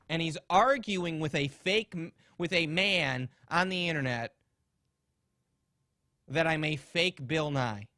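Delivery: normal talking voice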